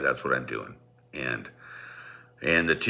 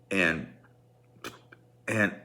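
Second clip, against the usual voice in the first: normal talking voice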